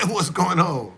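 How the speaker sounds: loud, high, laughing welcome; real delighted laughter at a visitor; laughing greeting. Real, not acted. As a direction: laugh loudly as a friend walks in. Laughs greeting someone who walks in.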